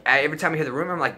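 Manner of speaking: ordinary talk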